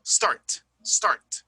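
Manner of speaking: "start" ending in a hard released t; aggressive final T. Shown as a demonstration. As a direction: punch the last t of "start". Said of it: "ends very aggressive".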